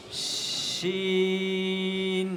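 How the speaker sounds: slow শিন for the class to repeat; drawn-out shin. Shown as a demonstration. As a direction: hold the sh of শিন long. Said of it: "আমার সাথে সাথে সবাই বলো".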